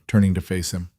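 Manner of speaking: ordinary delivery, nothing marked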